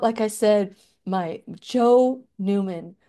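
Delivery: ordinary delivery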